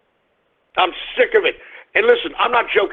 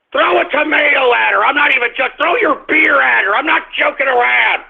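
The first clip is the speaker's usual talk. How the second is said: angry call; 7 semitones higher than usual. Real, not acted. Vlogger urging Super Bowl fans to pelt the singer, angry call. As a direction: push it up into a heated order.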